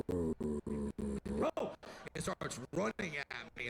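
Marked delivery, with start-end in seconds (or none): 0.1-1.8 low, drawn-out dopey dog; a big dumb dog spotting him; dog spotting him from across the room, low and slow. Retold or imitated. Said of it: "just goes".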